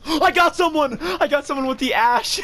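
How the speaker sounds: very high-pitched excited squeal; thrilled. Real, not acted. Gamer telling his team about a hit, thrilled squeal. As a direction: burst out in giddy triumph.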